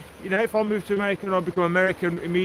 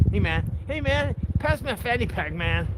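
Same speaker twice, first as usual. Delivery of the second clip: higher-pitched mock American accent; 5 semitones higher, slower than usual. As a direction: put on a high American dude voice. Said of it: "Except we say fanny pack".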